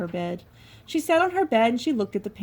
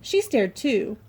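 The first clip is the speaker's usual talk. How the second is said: usual speaking voice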